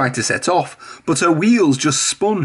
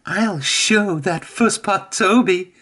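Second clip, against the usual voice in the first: higher, slower, muttered to herself; defiant Mavis; 5 semitones higher, slower than usual. A narrator's reading. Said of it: "said Mavis to herself".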